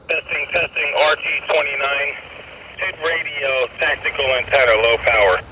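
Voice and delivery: heard through a radio; as if over a handheld radio; over walkie talkie. Shown as a demonstration. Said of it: "I just wish the audio was clearer on that".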